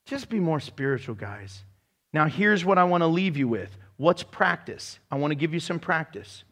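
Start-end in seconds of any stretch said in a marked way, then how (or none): 0.1-1.6 a soft, casual shrug of a line; soft offhand advice. Retold or imitated. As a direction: toss it off quietly. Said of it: "And he's like".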